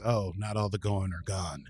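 normal talking voice

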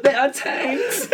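high, slow mock-German cry through laughter; German accent. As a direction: play a German soldier in comic alarm. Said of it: "I'm doing a German accent".

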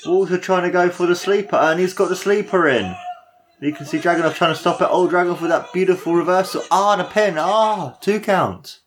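high, quick excited play-by-play; calling it fast with excitement. Real, not acted. Fan calling near-fall sequence.

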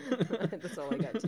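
laughing through the words. Real, not acted. Laughing as she repeats the line to her friend. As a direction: giggle it out, amused.

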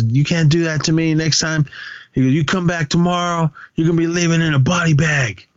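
high-pitched mock threat; acted threat. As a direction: imitate a riled-up bully, no shouting. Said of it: "Victor threatened".

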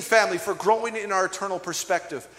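ordinary delivery

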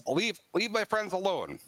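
put-on high, defiant boy telling mermaids off; a lightly voiced feral boy standing his ground; boyish voice. Wild boy ordering the mermaids to leave. Pan defends his new friends.

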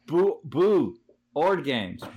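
"Boo, Boo Ord games" slow and ghostly, Boo stretched; spooky pun. Shown as a demonstration. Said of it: "You get it?".

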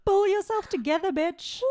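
high, loud pep talk; mock reprimand. Host ending a giggle fit, mock-stern. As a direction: order them jokingly to get a grip. Jokingly orders end of laughing.

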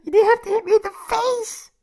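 high, fast, whiny and hurt; tearful complaint. Acted. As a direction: sob out the complaint. Just punched in the face.

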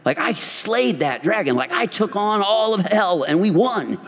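high, pumped-up boast, voice climbing high; cocky bragging. Retold or imitated. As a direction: crow about the win like a hero. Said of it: "I'm like".

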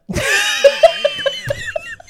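high shrieking laugh; shrieking cry laugh. Real, not acted. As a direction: let out a wild shriek of laughter. Shrieking laugh after quote.

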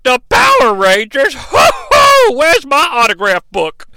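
high, loud fake fanboy squeal with a hoot; mock being starstruck; villain hamming up delight at meeting heroes; mocking fake excitement. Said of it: "And he's like".

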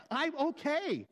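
high, testy insistence; insistent denial. Shown as a demonstration. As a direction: pitch it up and insist you're fine. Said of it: "but they express different meanings".

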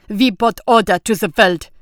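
loud, forceful declaration; furious shout. A narrator's reading. Banker slamming the table at the countess, a loud furious shout. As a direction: bang it out like a fist on the table. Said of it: "slammed his fist on the table".

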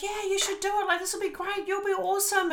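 high-pitched, gushing encouragement; cheerleading group. Retold or imitated. The breakout room urging her to do it, high cheerleading voice. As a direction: mimic an over-eager chorus of supporters. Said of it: "everyone's like".

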